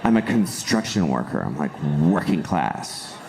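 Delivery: low, slow, self-admiring mock tough guy; hammed-up low macho brag; Matt Damon. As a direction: play a working-class hero boasting in a low voice. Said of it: "he basically sat down, he's like".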